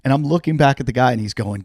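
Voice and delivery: ordinary delivery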